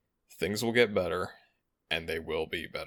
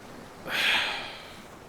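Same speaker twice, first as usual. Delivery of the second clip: long exhaled sigh; resigned sigh. Acted. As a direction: let out a long breath of reluctance. Dragon gives in to companion.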